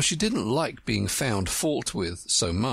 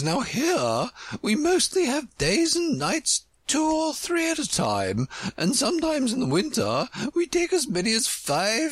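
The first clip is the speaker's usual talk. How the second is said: high-pitched, boastful queen; superior Red Queen; 14 semitones higher than usual. Acted. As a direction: explain your country's ways in a high, lofty voice.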